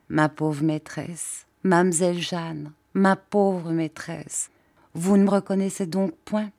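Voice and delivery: usual speaking voice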